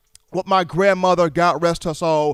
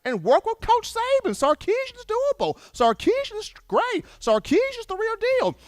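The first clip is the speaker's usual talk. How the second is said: much higher, mock-gushing praise; suddenly impressed fans; 13 semitones higher than usual. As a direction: rave about him, pitch climbing high. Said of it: "got everybody saying".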